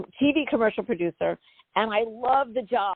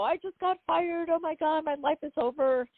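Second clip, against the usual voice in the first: replaying her own tearful despair; tearful wail; 8 semitones higher than usual. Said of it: "And I'm crying, really crying".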